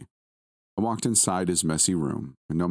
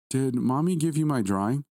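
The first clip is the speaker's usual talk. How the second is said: put-on small boy, a little shaky; 9 semitones higher than usual. A narrator's reading. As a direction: ask nervously with a slight tremble. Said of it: "in a somewhat shaky voice".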